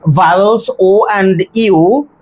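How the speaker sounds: ordinary delivery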